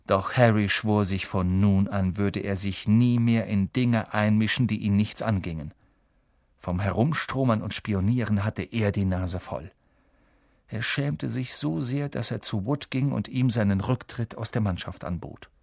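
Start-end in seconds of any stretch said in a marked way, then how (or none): none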